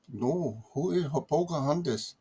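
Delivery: lightly put-on puzzled caller; imitated caller with Pakistani accent. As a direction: mimic a Pakistani-accented caller who has no idea. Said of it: "with a Pakistani accent".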